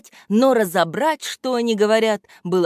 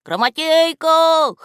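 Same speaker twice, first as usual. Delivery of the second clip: slow, loud, high desperate call; frantic cry; 10 semitones higher, 6 dB louder, slower than usual. A narrator's reading. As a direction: shout his name for help. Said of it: "послышались чьи-то вопли". (отчаянный крик о помощи)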